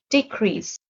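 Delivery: usual speaking voice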